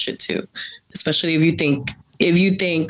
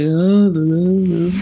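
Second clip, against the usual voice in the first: elongated Salvadoran drawl; 4 dB louder, much slower than usual. Retold or imitated. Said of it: "they elongate their words a lot".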